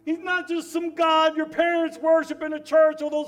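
loud, high, impassioned proclamation; preaching at full force. Real, not acted. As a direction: build to a fervent shout that he's real. Preacher building to climax.

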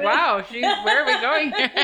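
high, laughing retold surprise; mock alarm through laughter. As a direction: giggle out the worry. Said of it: "I was like".

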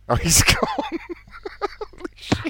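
breaking into high laughter; can't stop laughing. Real, not acted. Prank caller cracking up.

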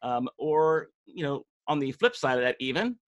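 ordinary delivery

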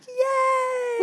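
a squealed fake hooray; mock cheer. Mom telling friend her sarcastic reaction, squealed mock cheer. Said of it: "And I'm like".

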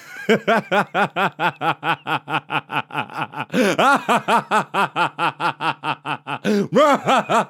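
long machine-gun cackle; intense laugh. Real, not acted. Host cracks up at being very relaxed, long intense cackle. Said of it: "That was intense".

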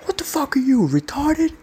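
mock the giant husband's outrage, pitch way up; imitating an angry guy. Huge husband confronting the podcaster, hammed-up high mock anger. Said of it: "and he goes".

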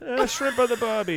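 high mock Australian line through a laugh. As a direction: toss off an Australian cliché, laughing. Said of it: "mock Australian phrase".

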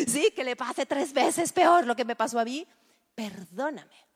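mock spiteful wish. Preacher joking about a vengeful prayer, mock spite. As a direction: gleefully wish triple harm.

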